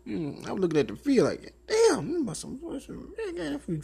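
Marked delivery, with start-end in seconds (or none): 1.7-3.8 mock awe. Imitated ogling.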